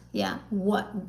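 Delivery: ordinary delivery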